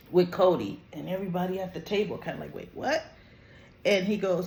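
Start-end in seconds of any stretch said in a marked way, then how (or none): 2.5-3.0 mock bewilderment. Everybody at the table reacting to the boy, puzzled. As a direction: imitate a puzzled reaction, pitch jumping up.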